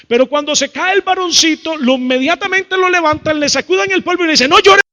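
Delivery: high, loud rush building to a shout; climbing to a mock parental shout; urgent scolding build-up. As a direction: race up to a yelled command, like an alarmed parent. Acts parents urgently scolding fallen boy.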